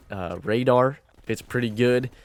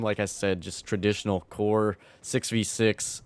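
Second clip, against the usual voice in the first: ordinary talk, nothing marked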